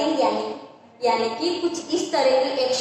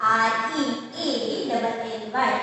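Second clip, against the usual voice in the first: slow spelling; slower than usual. Shown as a demonstration. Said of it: "कौन सा word से?".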